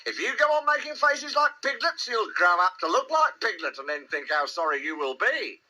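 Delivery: high-voiced motherly scolding; rather severe. A narrator's reading. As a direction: lecture a naughty child firmly. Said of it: "said Kanga rather severely".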